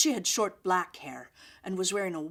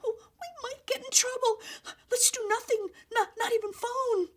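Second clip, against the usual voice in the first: very high, tearful squeak; frightened squeak. A narrator's reading. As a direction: squeak it out, scared of trouble. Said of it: "Violet squeaked".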